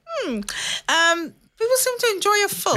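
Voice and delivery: high-pitched, wondering mum impression; surprised mum. Mum commenting on her child's comedy, a little surprised. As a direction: react as if baffled that anyone liked it. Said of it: "No, no, that's surprise".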